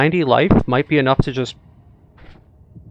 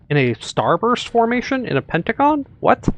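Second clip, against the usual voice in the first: surprised; 10 semitones higher than usual. Real, not acted. Surprised at the shot pattern.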